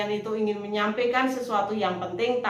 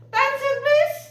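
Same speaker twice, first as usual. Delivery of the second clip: much higher and louder announcement; loud call; 5 dB louder than usual. Shown as a demonstration. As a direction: cut through the crowd noise. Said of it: "maka kalian harus lantang". (seruan keras meminta perhatian)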